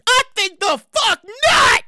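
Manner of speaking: slow, word by word, punching NOT; indignant refusal. Real, not acted. Host balking at the dentist's price per filling, indignant. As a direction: space out each word and hit NOT hard.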